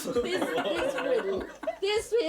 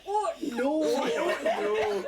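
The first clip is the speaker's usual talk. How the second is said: higher, slow put-on Scottish voice, laughing; bad Scottish accent; 9 semitones higher, 3 dB louder, slower than usual. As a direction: ham up a Scottish brogue. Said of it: "bad Scottish accent".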